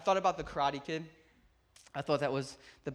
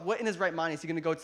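ordinary delivery

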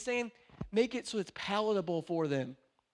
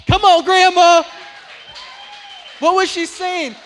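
much louder, higher shout to the crowd; excited shout; 10 semitones higher, 18 dB louder than usual. Real, not acted. Preacher shouting to the congregation, excited shout. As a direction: rouse the room.

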